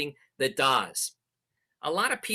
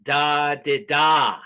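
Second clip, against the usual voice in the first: loud, drawn-out "da, di, da"; imitated Morse tones, firmly sounded; spoken Morse code sound; 6 dB louder, much slower than usual. Shown as a demonstration. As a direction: voice the code with long das and a short di. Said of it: "a sound like".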